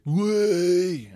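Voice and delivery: loud, high lairy "Way!"; mock lad shout. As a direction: bellow it like a lad crashing in. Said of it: "don't enter a place just".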